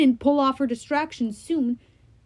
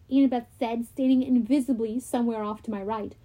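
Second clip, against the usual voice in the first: ordinary delivery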